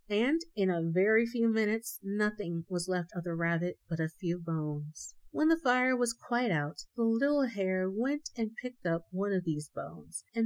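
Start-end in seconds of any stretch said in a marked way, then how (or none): none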